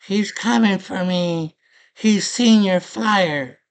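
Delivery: high, loud, drawn-out wail; hammed-up panicked princess, stretching the words slowly; mock screaming princess. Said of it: "The princess is screaming".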